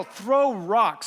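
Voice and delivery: half-volume battle cry; retold yell. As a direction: call out an order to the gang. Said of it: "Then I yell".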